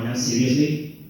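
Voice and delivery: normal talking voice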